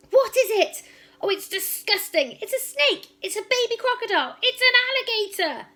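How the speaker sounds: high, loud panicked shrieks; panicked shrieking. Acted. Trunchbull shrieks in panic at the newt in her glass. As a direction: shriek as if something is crawling at you. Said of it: "let out a yell and leapt".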